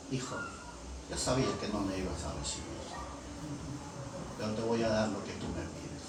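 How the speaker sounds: low, soft voice of God; hushed, deep acted reply. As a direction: speak down gently, intimately to a child. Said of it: "bajó su voz y me dijo".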